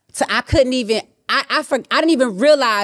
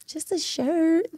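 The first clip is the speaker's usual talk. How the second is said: small sheepish voice; 9 semitones higher, 6 dB quieter, much slower than usual. Retold or imitated. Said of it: "she was like".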